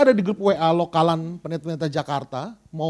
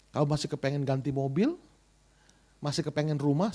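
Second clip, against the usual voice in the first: voice of God; 5 semitones lower, 8 dB quieter than usual. Retold or imitated. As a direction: confront gently in a deep, quiet voice. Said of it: "Seolah berkata gini". (suara Tuhan menegur, rendah dan pelan)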